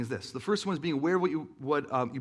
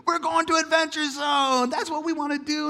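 put-on excited kids; 12 semitones higher, 7 dB louder than usual. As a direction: cheer it like mock-thrilled children. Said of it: "'Cause then they bring up all".

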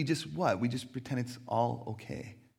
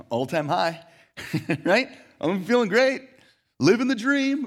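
high, loud, forced-bright fake cheer; fake cheerful; 6 semitones higher, 7 dB louder than usual. To a coworker asking how you are, loud fake cheer. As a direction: chirp it out like a smile pasted on. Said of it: "put the smile on and".

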